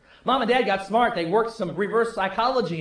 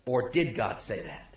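much lower and softer, insinuating tempter; sly serpent's question; 11 semitones lower, 8 dB quieter than usual. Acted. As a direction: plant the doubt low and quiet like the serpent. Serpent's doubt, whispered aside.

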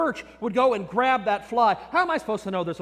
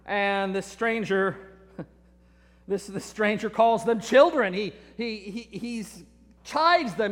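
normal talking voice

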